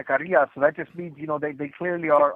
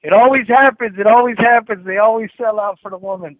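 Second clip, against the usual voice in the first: mock outrage; 6 semitones higher, 10 dB louder than usual. Real, not acted. Mock lament about selling out.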